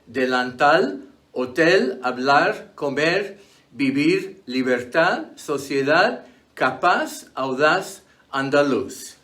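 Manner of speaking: delantal, hotel, andaluz, each stressed on the final syllable; stress on last syllable. Shown as a demonstration. Teacher giving examples like capaz, each stressed on the last syllable. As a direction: list the Spanish words, landing on each last syllable as in andaluz. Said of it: "are stressed on the last syllable".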